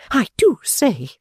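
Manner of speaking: high, slow, offended insistence; indignant repeat. A narrator's reading. Fanny repeating her complaint to Pa, high and slow. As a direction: insist again, affronted. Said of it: "she repeated".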